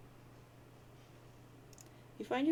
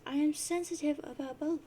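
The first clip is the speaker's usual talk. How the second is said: put-on a higher, teasing girl's voice; Terezi voice; 7 semitones higher, 4 dB louder than usual. Terezi answering John's jab, lightly voiced and teasing. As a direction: tease him like a prickly troll girl.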